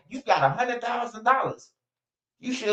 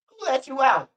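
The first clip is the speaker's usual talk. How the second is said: mocking her question; 7 semitones higher than usual. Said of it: "she asked the motherfucking dog".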